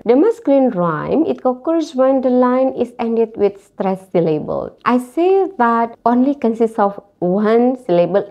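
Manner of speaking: usual speaking voice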